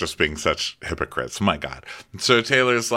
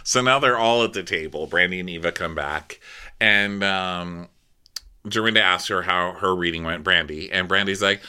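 ordinary delivery

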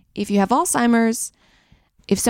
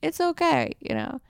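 softer, higher comforting okay; gentle reassurance; 7 semitones higher, 7 dB quieter than usual. Retold or imitated. Reassuring a crying girl at the gym, soft and gentle. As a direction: comfort her softly. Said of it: "And I'm like".

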